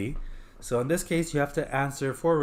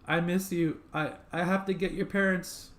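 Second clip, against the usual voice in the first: higher-pitched acted sob; mock sobbing man; 8 semitones higher than usual. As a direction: play a man tearfully coming to his senses. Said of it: "male, sobbing".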